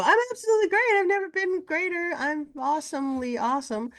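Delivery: hammed-up, very high gushing; pretending to be great. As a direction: overdo the fake cheer, high and loud at first. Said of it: "The more I pretend and go".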